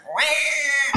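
high mock baby cry "Wah!"; imitated baby wail. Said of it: "the baby's just like".

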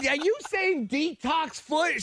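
high, slow retold question; stretched-out, high-pitched incredulous query; baffled calling back. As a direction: imitate calling back in disbelief. Calling back, unsure he heard right.

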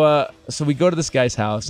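usual speaking voice

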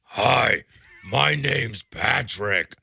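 loud, slow caricature of his own voice; mock gruff self-impression. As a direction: overdo your friends' gruff impression of you. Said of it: "made an impression of my voice".

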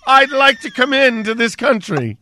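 a hint of a put-on Chinese accent; lightly voiced immigrant, playful; mock Chinese accent. Co-host jokes about newcomers, mock Chinese accent. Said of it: "I say".

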